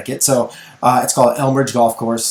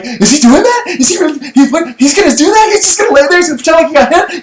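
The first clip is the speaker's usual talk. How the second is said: put-on high, giddy kid voice, much higher and louder; excited kid; 13 semitones higher, 5 dB louder, faster than usual. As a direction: squeal it like a thrilled eleven-year-old. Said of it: "my son is like".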